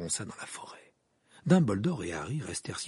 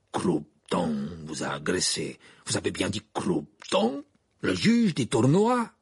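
incredulous Karkaroff; 5 dB louder, slower than usual. Acted. Karkaroff hears who attacked his student, incredulous. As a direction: repeat it in disbelief, rising to outrage.

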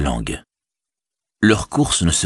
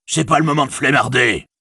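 plus haut, râleur d'orque; rabroue-les avec mépris; pestant; 7 semitones higher than usual. Acted. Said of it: "pesta-t-il". (orque qui peste, voix haussée)

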